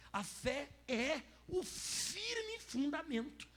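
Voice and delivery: slow, high, drawn-out proclamation; preached slowly. Acted. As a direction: stretch every word of the creed. Preaching the definition. (proclamação lenta e arrastada)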